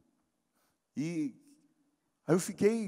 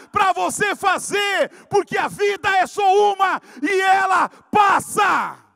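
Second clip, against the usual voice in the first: full-force preaching; 8 semitones higher, 5 dB louder than usual. Acted. Pastor exhorts the church never to stop, preaching hard. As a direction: drive it home to the congregation.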